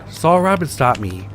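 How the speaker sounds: usual speaking voice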